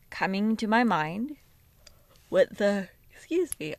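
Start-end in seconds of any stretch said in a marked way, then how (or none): 2.3-3.7 talking through a yawn; words stretched by a yawn. Real, not acted. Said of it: "I'm yawning".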